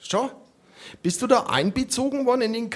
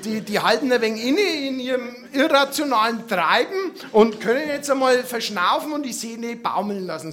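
ordinary delivery

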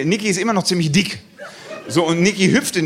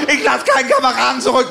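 high battlefield yell; hammed-up soldier's heroic cry; war-movie shout; 7 semitones higher, 3 dB louder than usual. Friend in war mode over a fallen comrade, dramatic shout. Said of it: "in so 'n Kriegsmodus rein".

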